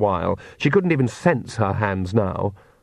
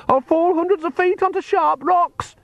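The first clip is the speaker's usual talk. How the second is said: extremely high terrified shriek. A narrator's reading. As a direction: shriek in dread of falling. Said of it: "Agnes shrieked".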